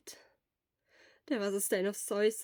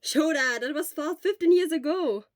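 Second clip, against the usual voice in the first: Hisashi's voice; 7 semitones higher, 10 dB louder than usual. Acted. Hisashi speaking to Shota.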